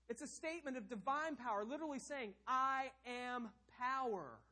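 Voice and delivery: high, loud preaching, the last words slowed; fiery pulpit preaching; preaching at full force. Acted. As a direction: preach it with rising fire. Preaching climax on divine power.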